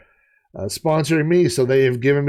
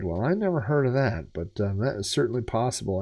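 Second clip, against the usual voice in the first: normal talking voice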